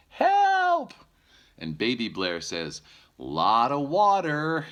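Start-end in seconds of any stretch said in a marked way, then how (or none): 0.1-0.9 very high, loud panicked shriek; shrieking for help. Acted. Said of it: "Missus Blair shouts". 3.2-4.6 put-on high, loud, slow little baby voice; happy baby voice. Baby bear voiced in story.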